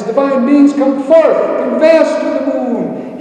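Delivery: loud, high summons to the gods; grand ringing call, pitched high; bellowed invocation. A narrator's reading. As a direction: bellow it up into the heavens. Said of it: "he bellowed all the more into the heavens".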